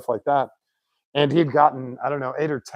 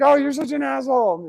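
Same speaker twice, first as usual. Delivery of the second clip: high-pitched mock yell; imitated yelling back; 12 semitones higher than usual. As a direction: holler it back in playful outrage. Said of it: "yelling back at him".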